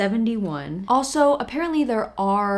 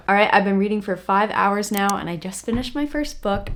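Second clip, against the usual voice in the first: ordinary talk, nothing marked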